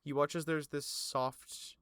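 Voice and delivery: usual speaking voice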